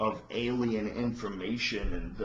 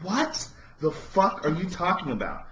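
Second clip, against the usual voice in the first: high, louder stunned disbelief; incredulous; 8 semitones higher, 5 dB louder than usual. Retold or imitated. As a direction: react like you can't believe your ears. Everybody reacting in disbelief.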